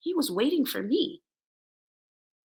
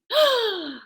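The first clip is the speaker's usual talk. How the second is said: mock gasp; 10 semitones higher than usual. Realization in the story.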